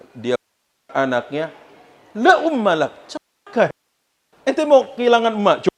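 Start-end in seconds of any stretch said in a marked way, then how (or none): 2.1-5.7 scolding father. Acted.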